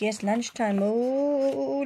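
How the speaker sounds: usual speaking voice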